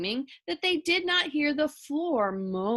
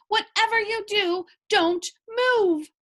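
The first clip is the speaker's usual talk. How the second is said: higher and louder, firm; urgent warning; 9 semitones higher, 5 dB louder than usual. A narrator's reading. Husband warning his wife and pig, urgent shout. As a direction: insist nobody moves an inch. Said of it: "shouted Mister Watson".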